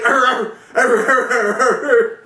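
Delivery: laughing mock barks, high-pitched; a hammed-up angry dog imitation; mock dog barking. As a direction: bark like an angry dog while cracking up. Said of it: "I'll just say the dog's like".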